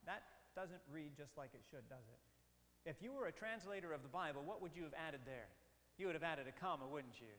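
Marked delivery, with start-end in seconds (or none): none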